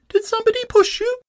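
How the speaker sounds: very high and fast; excited little Roo. Acted. Roo asks Eeyore eagerly, very high and fast. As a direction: rattle it off like a tiny eager kangaroo. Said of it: "said Roo excitedly".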